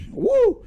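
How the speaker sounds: excited whoop. Real, not acted. Cheering after the movie.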